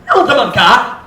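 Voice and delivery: loud rising sharp "Na unsa man ka?"; loud accusing demo; harsh tone. Shown as a demonstration. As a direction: snap the question at them. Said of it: "Listen to the difference here".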